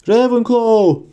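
high, ringing hat's proclamation; drawn-out triumphant shout; shouted announcement. A narrator's reading. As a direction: call out the house name high and loud. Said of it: "diye bağırdı şapka".

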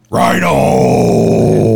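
booming announcer shout; monster truck ad. Retold or imitated. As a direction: roar it like a stadium promo. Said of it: "Is that how he says it on TikTok?".

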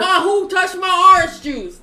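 high, loud, slow yell at mom; whiny grown son. Retold or imitated. Grown man yelling to his mom over juice, whiny high yell.

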